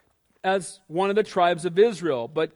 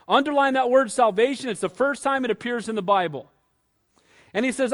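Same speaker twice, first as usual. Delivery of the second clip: ordinary talk, nothing marked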